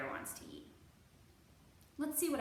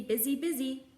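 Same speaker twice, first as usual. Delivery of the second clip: ordinary delivery, nothing marked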